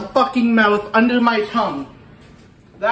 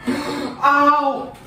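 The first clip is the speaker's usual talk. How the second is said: sharp high cry of pain; pained yelp; 6 semitones higher than usual. Real, not acted. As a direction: yelp as the burn hits. Burning pain from pepper.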